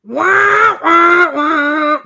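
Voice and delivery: loud, high squawked wah wah wah; mock serenade squawk; Scuttle's squawking song. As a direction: belt it out like a bird trying to sing. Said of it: "And he goes".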